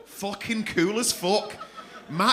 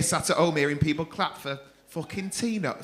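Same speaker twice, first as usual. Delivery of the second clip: ordinary talk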